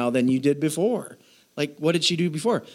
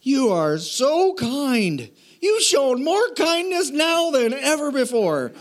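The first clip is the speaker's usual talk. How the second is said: higher, slow, gushing mock Boaz; 10 semitones higher, slower than usual. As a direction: ham up a clueless sweet thank-you. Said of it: "And then Boaz is like".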